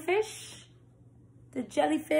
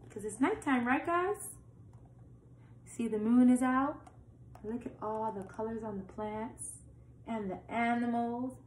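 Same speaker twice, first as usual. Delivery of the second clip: plain reading voice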